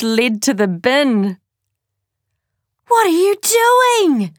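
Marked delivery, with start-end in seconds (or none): 2.9-4.3 shriek. Acted. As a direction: shriek it out in horror. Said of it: "shrieked the twins".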